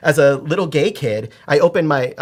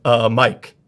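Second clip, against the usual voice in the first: lower, put-on manly voice; 4 semitones lower than usual. Shown as a demonstration. Ordering at Starbucks, a deep-set man voice. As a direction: drop into a deliberately manly voice. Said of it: "I do this".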